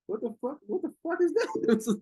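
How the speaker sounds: rising into a high mock-shocked cry; shocked disbelief. Recounting his reaction to the Ebony account, high shocked disbelief. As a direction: act floored by what you just saw. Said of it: "I was like".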